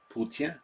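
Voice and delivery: Poutine said the French way, pitched high; French pronunciation. Shown as a demonstration. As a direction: end Poutine on a nasal French vowel, rising. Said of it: "That's how you say it".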